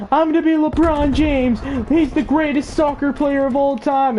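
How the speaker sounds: put-on high-pitched insistent kid voice; mock whiny boast. Kid insists on his future to cop, mock whiny boast. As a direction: imitate a child boasting in a high whine.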